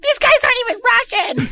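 very high falsetto exclamation; mock outraged partygoer. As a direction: squeal the accusation in mock outrage. Retold outraged friend exposing the prank.

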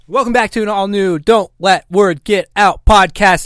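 loud hyped-up show opener; podcast intro yell. Shown as a demonstration. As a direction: yell the welcome like a host. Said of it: "me yelling has scared my".

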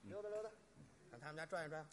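soft, idle self-talk while play-acting a stroll; musing to oneself. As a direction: murmur it to yourself casually. Resumes acting the stroll, to himself. (小声嘟囔着自言自语)

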